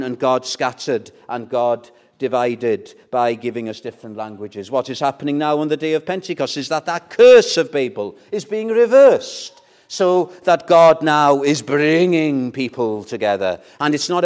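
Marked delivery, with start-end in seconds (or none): none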